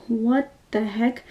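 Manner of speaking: hushed, slow mock disbelief; drawn-out, quiet imitation of shock; retold shock. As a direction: say it softly and slowly, imitating someone stunned. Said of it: "She's, like, in total shock".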